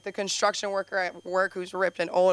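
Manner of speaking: normal talking voice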